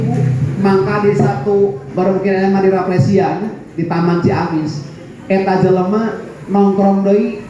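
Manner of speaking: normal talking voice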